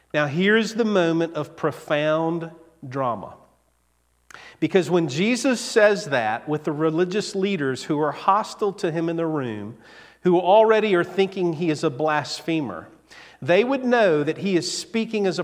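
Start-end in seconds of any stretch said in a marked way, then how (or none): none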